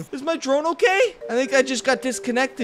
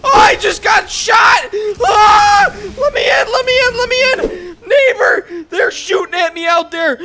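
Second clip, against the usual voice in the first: frantic, crying shout, much higher and louder; panicked screaming; 6 semitones higher, 6 dB louder than usual. Real, not acted. Gamer screaming at his neighbor to let him in, panicked. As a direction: beg to be let in like you're under fire. Shot at, begging neighbor for shelter.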